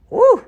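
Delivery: sudden high whoop of excitement; excited woo. Real, not acted. Preacher hyping point three, high excited whoop. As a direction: let out a big excited woo.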